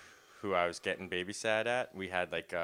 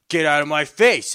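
loud aggressive yell, higher; mock yell; 8 semitones higher, 17 dB louder than usual. Army guy shoving into a man's face, yelling. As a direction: bark it like an angry soldier.

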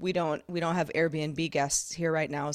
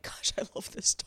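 hushed, nearly whispered affection; tender, breath-heavy murmur; welling up; 7 dB quieter than usual. Retold or imitated. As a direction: say it softly, as if getting teary. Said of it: "You get, like, teary-eyed".

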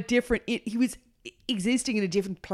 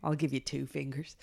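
under my breath; 5 semitones lower, 8 dB quieter than usual. Retold or imitated. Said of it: "said something under my breath to myself".

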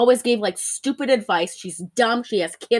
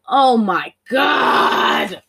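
long, drawn-out loud groan; hammed-up, very slow exclamation; exasperated; 5 dB louder, much slower than usual. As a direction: stretch each word in mock despair. Quoting own exasperated reaction.

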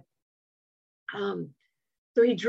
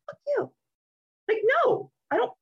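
mad retort. Retold or imitated. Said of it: "the first time I experienced being mad".

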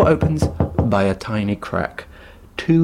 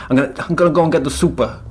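quick quoted line; 4 semitones higher, much faster than usual. Retold or imitated. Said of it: "They go".

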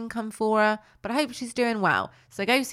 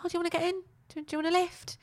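high, soft little toy voice; light, high put-on character voice; Noddy's girlish voice; 10 semitones higher, 5 dB quieter, much faster than usual. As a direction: offer it timidly in a high girlish pitch. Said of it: "it's got a girl's voice".

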